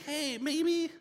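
high, hesitant brother voice. Retold or imitated. Judah talks his brothers out of killing, high and tentative. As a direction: pitch it up like a nervous brother proposing a way out. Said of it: "Judah, he's like".

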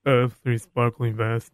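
put-on low, slow, mumbling teen; bored teen. Host mocking a teen caller's dull answer, low and slow. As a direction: drag it out like a bored teenager. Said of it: "if he just answers the question like Anthony the Teen".

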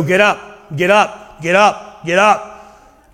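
insistent repeated wake-up call; acted nagging parent at the bedside; parent waking teenager. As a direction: rouse a stubborn teenager, evenly repeated. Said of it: "like he's talking to a teenager who won't".

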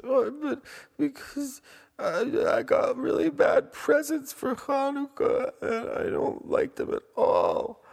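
very high, loud bratty wail; crying boy. Acted. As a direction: whine tearfully like a spoiled child. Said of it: "why are you crying".

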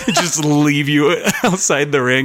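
slow, chuckle-broken words; laughing. Real, not acted. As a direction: break up laughing mid-joke. Joking while laughing.

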